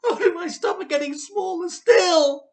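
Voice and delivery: high, loud, panicky wail; wife panicking over her mother; panicked cry. Acted. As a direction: cry out in a fluster. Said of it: "she cried".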